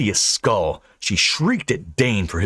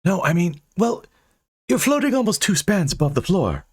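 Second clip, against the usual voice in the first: put-on higher, flustered young man; flustered Dane; 11 semitones higher than usual. Dane points out she floats. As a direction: stammer it out, baffled.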